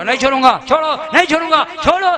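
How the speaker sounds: from firm refusal building to loud shouting; escalating argument. Acted. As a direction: quarrel building to a yell. Drunk and prophet argue, escalating.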